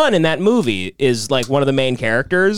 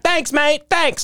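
put-on British accent; 14 semitones higher, much slower than usual. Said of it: "Of course, British".

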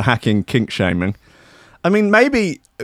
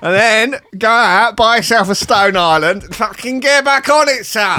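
higher, brash mock Cockney geezer; hammed-up Cockney mate telling him to buck up; mock Cockney lad; 7 semitones higher than usual. Cockney friend urging his mate to liven up, brash. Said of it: "Cockney Jesus".

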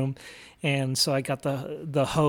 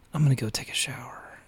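hushed whisper, barely voiced; sneaky whisper while others sleep; whispered aside; 2 semitones lower, 3 dB quieter than usual. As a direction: whisper it so nobody wakes. Retells sneaking out at dawn.

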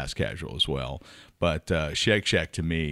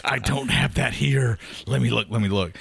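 imitating flustered Jay; 5 semitones higher than usual. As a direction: play the co-host rummaging through his notes. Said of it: "Jay is looking feverishly at his notes".

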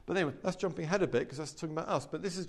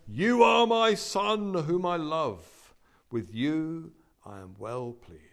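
voice of God; 3 semitones higher, 7 dB louder, much slower than usual. Acted. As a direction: proclaim it slowly as the Father's voice from heaven. Preacher voices God's words from heaven.

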